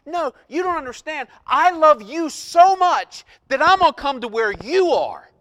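high, loud, impassioned declaration; God speaking. Acted. God telling his people of his love, loud and impassioned. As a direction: insist on love at full force. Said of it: "and he's saying".

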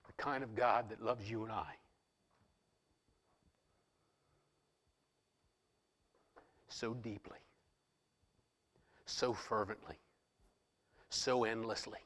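soft intimate preaching close to a whisper; hushed preaching. Acted. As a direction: draw them in with a hush. Quiet intense climax of sermon.

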